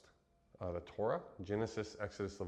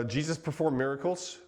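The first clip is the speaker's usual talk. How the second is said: loud, higher, with rising stress on performed; a bold headline, louder, pushing up on performed; emphatic announcement; 6 semitones higher, 5 dB louder than usual. Real, not acted. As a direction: announce it boldly to the room. Restates his point after a pause.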